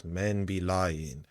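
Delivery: slow, drawn-out "men be lying"; mocking quote. Commentator mocking mother's takeaway, drawn-out quote. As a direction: stretch each word of the quoted lesson.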